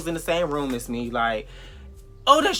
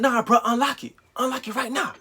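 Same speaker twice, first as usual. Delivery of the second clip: put-on demanding boyfriend; 9 semitones higher than usual. Date demands she unlock phone.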